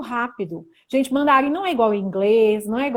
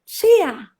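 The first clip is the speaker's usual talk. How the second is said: high, loud "Xia!"; exclamation; 7 semitones higher, 7 dB louder than usual. Shown as a demonstration. Teacher giving Chinese exclamation example, exclaimed high and loud.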